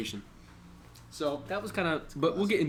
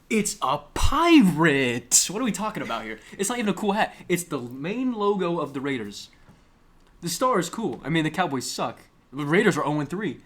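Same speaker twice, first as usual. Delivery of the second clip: loud, higher-pitched comic exasperation; upset rant; 4 semitones higher, 7 dB louder than usual. Real, not acted. Podcaster upset over fan's hat logo, exasperated rant. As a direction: rant in disbelief, punching it out loud. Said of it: "I was upset".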